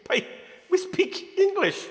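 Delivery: high, slow put-on Guyanese voice; mock Guyanese accent. Speaker tells coworker in Guyanese accent.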